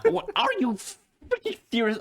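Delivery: high, indignant outburst; incredulous outrage. Acted. Piper snaps at a friend denying knowing her, indignant. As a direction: pitch it way up in disbelief.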